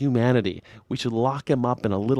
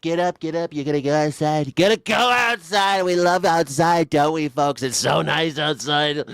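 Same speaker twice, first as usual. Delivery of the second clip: a gleeful dictator herding victims outside; Saddam impression; 5 semitones higher than usual. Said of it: "They just show him stand up and like".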